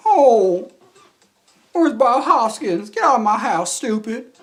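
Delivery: loud, high diva impression. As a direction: belt it out high, slow at first.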